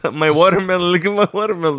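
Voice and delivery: a hint of bounding-dog eagerness; hyper dog. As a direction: offer it like an excitable pup. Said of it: "hyper as shit".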